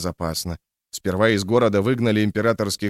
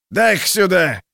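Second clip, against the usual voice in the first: grabbing thug voice; 8 semitones higher, 4 dB louder than usual. A narrator's reading. Grabs bottle.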